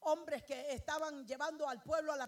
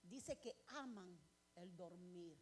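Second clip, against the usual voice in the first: drowsy mock sleeper; 8 semitones lower, 16 dB quieter, slower than usual.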